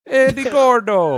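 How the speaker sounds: high, drawn-out mock Jamaican accent. Teasing a friend's accent attempt, in a mock Jamaican accent. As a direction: stretch the name out in a playful mock accent. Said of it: "Red Stripe guy".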